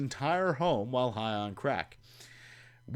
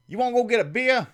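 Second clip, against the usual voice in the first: much higher, louder and fast; imitating Terry; 11 semitones higher, 8 dB louder, faster than usual. Terry pushing a late-night beer, high and fast. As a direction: toss off the offer eagerly. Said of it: "say things like".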